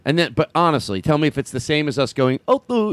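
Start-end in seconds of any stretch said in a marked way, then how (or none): 2.5-2.9 hint of an Indian accent. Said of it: "with an Indian".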